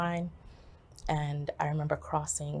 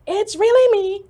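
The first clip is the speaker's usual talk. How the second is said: very high, loud reassurance; emphatic shout; 15 dB louder than usual. Retold or imitated. Daughter phoning her disbelieving mom, emphatic shout. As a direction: shout it to get through to her.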